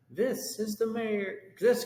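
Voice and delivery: high, slow mayor voice; mayor crying out. Acted. Mayor addressing the whole town, high and slow. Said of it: "cried the mayor".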